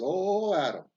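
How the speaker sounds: loud, high groaning cry with each swing; labor cry. Acted. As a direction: call out Adam as the axe lands. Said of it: "every time he swings the axe, he says".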